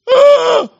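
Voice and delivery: high, loud mock wail. Acts out sudden hurt reaction.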